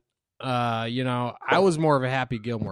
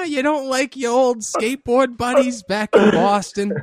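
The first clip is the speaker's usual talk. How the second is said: high, whiny Adam Sandler mom voice, louder; guilt-trip your old buddy like a nagging mom; mock nagging mother; 9 semitones higher, 4 dB louder than usual. Teasing Abe for never visiting, in a high nagging mom voice. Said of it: "Adam Sandler as Adam Sandler's mother".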